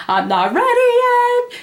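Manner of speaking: hammed-up emotional protest; mock crying. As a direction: whine it as if bawling. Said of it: "I started crying".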